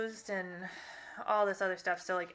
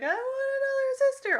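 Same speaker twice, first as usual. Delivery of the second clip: put-on much higher, pleading little-girl voice; little girl begging; 5 dB louder than usual. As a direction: beg like a small daughter wanting a sister. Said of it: "She's like".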